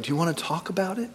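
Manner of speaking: sweet. Retold or imitated. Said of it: "she's real sweet".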